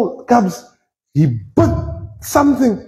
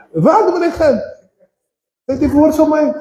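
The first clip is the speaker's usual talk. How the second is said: ordinary talk, nothing marked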